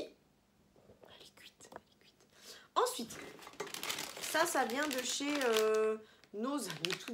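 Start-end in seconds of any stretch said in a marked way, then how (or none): 0.0-2.3 hushed whisper so the sleeping dog isn't woken. Real, not acted. To her sleeping dog, a soft unvoiced whisper. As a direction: breathe it out, barely any voice.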